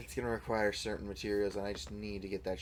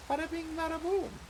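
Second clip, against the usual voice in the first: very high, sing-song wiseguy flourish; bada bing flourish. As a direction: toss it off like a showman. Showing off the build.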